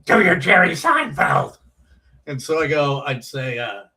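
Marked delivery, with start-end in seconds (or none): 0.0-1.6 loud, higher put-on voice calling out; Judy Tenuta's request. Comedian calls out loud to her opener. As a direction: shout it across the room, louder and higher. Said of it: "she always was like".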